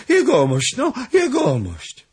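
high, loud protest; exasperated pleading. Acted. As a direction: call out to be let go. Squeezed servant begs for release. (błagalny, zniecierpliwiony okrzyk)

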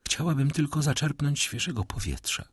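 high, weak lady's voice; lightly voiced faint woman; with effort. A narrator's reading. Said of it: "odrzekła z wysiłkiem".

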